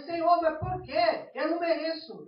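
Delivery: mock wounded appeal; acted protest. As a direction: complain to God, drawing out the question. Acted complaint to God over hardship.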